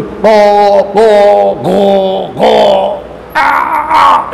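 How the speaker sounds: short guttural ghain and aa sounds; demo letter sounds. Shown as a demonstration. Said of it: "আমরা বলি".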